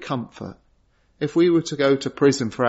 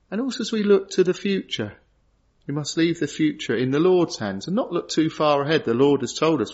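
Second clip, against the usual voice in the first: ordinary talk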